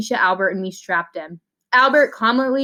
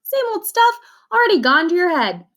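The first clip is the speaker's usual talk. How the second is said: much higher, louder mock disbelief; teasing friend; 9 semitones higher, 3 dB louder than usual. Keisha teases Allie, high and incredulous. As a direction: squeak it out in playful outrage. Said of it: "Keisha teasing reply".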